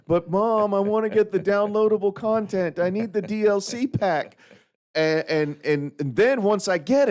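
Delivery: high pleading kid voice, a light mock; whiny kid begging. A grown man mocking a kid begging mom for DLC, lightly whiny. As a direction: beg like a kid who wants the game add-on.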